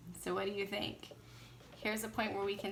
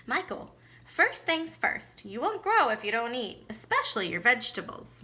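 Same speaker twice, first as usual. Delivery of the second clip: stern mother; 2 semitones higher, 7 dB louder than usual. A narrator's reading. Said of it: "Mama gave him a stern look".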